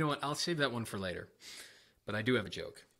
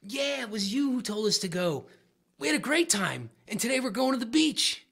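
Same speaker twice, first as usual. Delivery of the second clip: much higher, louder dopey-dude voice; goofy penguin owner; 13 semitones higher, 10 dB louder than usual. Acted. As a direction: play the clueless guy, pitched way up and loud. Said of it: "And the guy goes".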